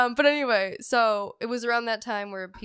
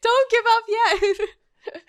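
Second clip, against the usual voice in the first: 1 mock pep talk; 12 semitones higher, 7 dB louder, much slower than usual. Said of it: "I was like".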